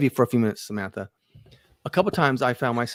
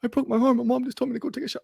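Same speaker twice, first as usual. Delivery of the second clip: put-on high kid's voice, upset and rushed; imitating son, much higher; son crying to dad; 12 semitones higher, faster than usual. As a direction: blurt it like a hurt boy to his dad. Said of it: "He's like".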